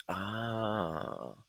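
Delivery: very soft, drawn-out tender sound; hushed sympathetic coo; empathetic sigh. Retold or imitated. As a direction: let it out softly, heart going out to her. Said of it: "When I can empathize with her".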